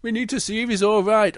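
high, urgent story-bear pleading; Big Bear. Acted. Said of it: "And Big Bear goes".

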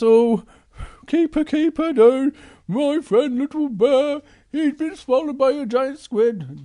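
high, urgent plea; panicked plea. Acted. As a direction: beg the keeper urgently. Big Bear begs keeper.

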